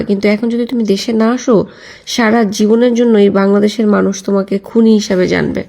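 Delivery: usual speaking voice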